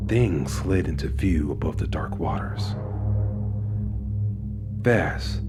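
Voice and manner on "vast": ordinary delivery, nothing marked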